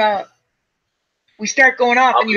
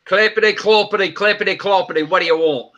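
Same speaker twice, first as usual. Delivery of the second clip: hammed-up talking horse with an English accent; clip-clop it out in a mock English accent; 2 semitones higher than usual. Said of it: "do an English horse".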